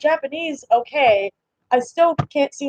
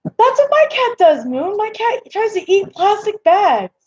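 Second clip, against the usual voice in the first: high-pitched, animated exclaiming; excited agreement; 9 semitones higher than usual. Real, not acted. Streamer to viewer about her cat eating plastic, excited. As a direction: leap in with delighted recognition, pitched way up.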